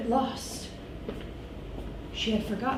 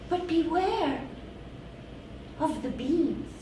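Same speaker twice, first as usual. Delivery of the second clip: ominous warning; 5 semitones higher, 5 dB quieter than usual. Acted. Flower warning.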